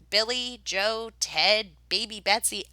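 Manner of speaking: plain reading voice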